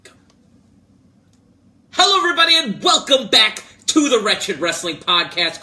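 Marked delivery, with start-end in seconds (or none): 1.9-5.6 loud, high, booming welcome; big hyped-up podcast intro; show-opening announcer. Acted. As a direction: kick off the show at full volume. Host shouts the show's intro.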